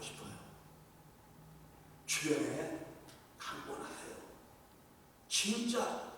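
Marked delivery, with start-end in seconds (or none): none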